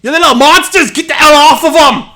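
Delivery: high, full-voiced furious yell; angry rescuer. Yaki smacking his way through the crowd with a frying pan, yelling. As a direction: roar at a mob of children to scatter. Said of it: "and you hear".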